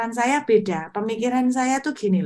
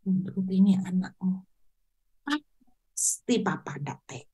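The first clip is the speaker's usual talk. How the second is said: heartfelt prayer. Retold or imitated. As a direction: plead tenderly like a child to a father. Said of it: "contoh simpelnya doanya tuh gini".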